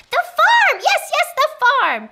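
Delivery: very high, loud eureka cry; wildly excited, high and loud; triumphant shout. Acted. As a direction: shriek with delight at your own idea. Witch has found the answer.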